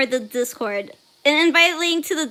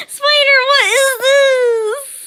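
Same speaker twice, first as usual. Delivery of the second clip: high, loud wail with words dragged out; slow, over-dramatic shriek of dismay; mock outrage; 10 semitones higher, 7 dB louder, slower than usual. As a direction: wail in playful horror at a friend. Reacting again to Spider's post.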